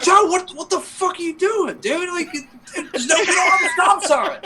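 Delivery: high, frantic disbelief at the driver; yelling at your buddy in a panic; retold frantic yell with laughter breaking through; panicked yell. Retelling yelling at stoned friend.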